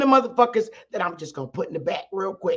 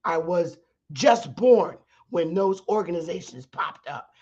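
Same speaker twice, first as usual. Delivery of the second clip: ordinary talk, nothing marked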